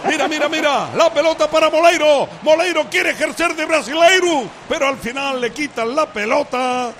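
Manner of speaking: play-by-play. Acted. Live radio match commentary.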